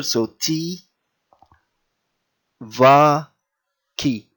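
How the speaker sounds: "T, va, ki" spaced out, "va" stressed; teacher spelling out the word; syllable by syllable. Shown as a demonstration. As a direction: sound out "T, va, ki" slowly. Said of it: "T, and then the E here".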